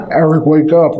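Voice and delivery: demon voice. Retold or imitated. A demon calling Eric awake in his delirium. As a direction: call his name like a demon would, loud and eerie. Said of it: "It kinda sounded like a demon".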